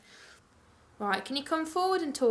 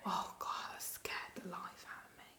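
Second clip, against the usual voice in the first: very soft, voiceless whisper; startled whisper; 4 semitones lower, 15 dB quieter than usual. Real, not acted. Ghost hunter just scared by a noise, startled whisper. Startled during hushed listening.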